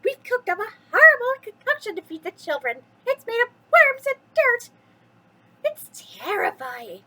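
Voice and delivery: high, loud gleeful witch voice. Acted. Witches boast to Jack about their gross concoction, high and loud. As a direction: crow it like a proud old witch.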